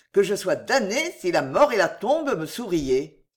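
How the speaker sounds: plain reading voice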